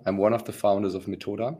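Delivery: ordinary talk, nothing marked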